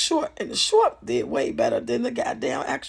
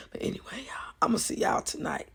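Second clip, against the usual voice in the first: whisper; 5 dB quieter than usual. Real, not acted. Signing off softly.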